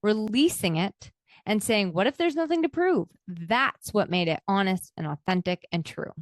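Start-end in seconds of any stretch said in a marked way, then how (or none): 1.9-3.1 higher and quicker, a freeing thought; light, lifted question. Acted. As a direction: ask "What if there's nothing to prove?" high, fast and relieved. Voices the releasing question.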